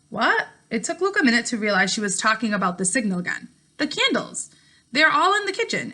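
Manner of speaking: steady, clear read-aloud narration for a class; story narration. A narrator's reading. Teacher reading a novel chapter to her class, in an even storytelling voice. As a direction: read the chapter out to your students, voicing each character lightly.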